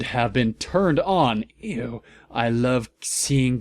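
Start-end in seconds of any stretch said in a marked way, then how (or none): none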